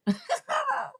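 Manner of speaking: high-pitched giggle; teasing laugh. Real, not acted. Psychic teasing a scared viewer, high laugh.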